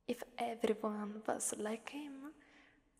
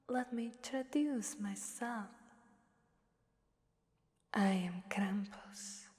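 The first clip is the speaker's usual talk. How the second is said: normal talking voice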